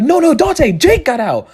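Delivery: high, urgent mock alarm; panicked warning. Neighbors warning Dante the dog got out, panicked.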